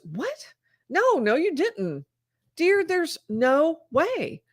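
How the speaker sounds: high-pitched, drawn-out denial, acting out the wife; retold disbelief. Wife refusing to believe her husband's confession, retold in disbelief.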